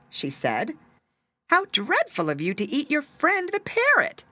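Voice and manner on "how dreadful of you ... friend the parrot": high-pitched shocked reproach; shocked scolding. A narrator's reading. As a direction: scold the cat in high dismay. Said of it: "she was shocked".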